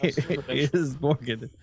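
slow, put-on Russian accent. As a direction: say it slowly with a mock Russian accent. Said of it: "in a Russian accent".

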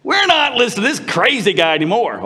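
high mock-angry refusal; hammed-up indignation, pitched high; acted ballistic outburst. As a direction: play a congregation losing patience. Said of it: "went ballistic".

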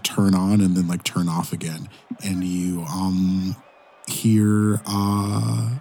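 normal talking voice

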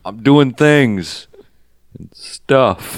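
slow, drawn-out southern parody; terrible southern accent. Acted. Podcaster parodies the actor's lines, slow terrible southern accent. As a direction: drag the words out in mock southern. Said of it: "his terrible southern accent".

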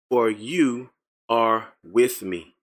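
for you are with me, drawn out slowly; slow reverent. Real, not acted. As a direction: linger on each word reverently. Slow reverent verse ending.

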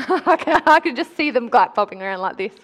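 giggling at the cute crowd; real laughter breaking through; laughing. Real, not acted. Laughs at masked congregation.

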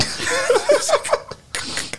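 high burst of giggling; a giggle bursting out, barely held; stifled giggles. Real, not acted. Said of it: "I'm trying not to laugh here".

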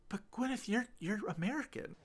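high, soft, faltering objection; hesitant mock protest. Audience member questions Gwyneth's British act, retold as hesitant protest. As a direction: imitate a timid, puzzled correction.

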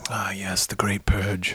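hushed, low near-whisper; soft, whispery mock reverence; mock-solemn reminiscence. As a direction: murmur it like a dark legend. Mock-solemn recall of a named event.

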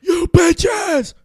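high, loud, slow screaming rant; Nicolas Cage rant. Retold or imitated.

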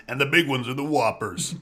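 lightly put-on character voice; almost Jonathan Banks. Host explaining which candies are bigger, lightly put-on voice. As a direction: slip briefly into a half-accidental impersonation. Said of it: "I don't know why I did that voice".

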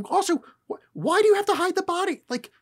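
high, incredulous question; baffled outrage; exasperated. Real, not acted. Exasperated rant.

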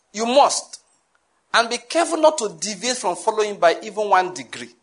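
ordinary talk, nothing marked

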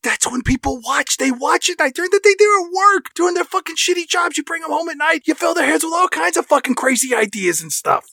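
high, eager sales pitch; excited henchman pitch. Pretend. Henchman pitching night programming.

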